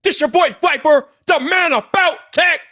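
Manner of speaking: shouted, high hype-man opener, drawn out and roaring; hype show intro. Acted. Host opening his Friday night live stream, shouting hype-man style. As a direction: pump up the crowd like an arena announcer.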